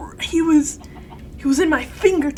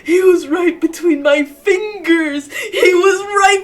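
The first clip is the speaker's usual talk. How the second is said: slow, high, loud crying wail; wailing lament; 6 semitones higher, 8 dB louder, much slower than usual. Real, not acted. Gamer wails over the character who slipped away, crying slowly. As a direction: grieve the lost pull, sobbing and laughing.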